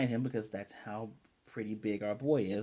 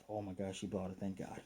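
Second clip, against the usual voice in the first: relieved aside; 5 semitones lower, 11 dB quieter than usual. Retold or imitated.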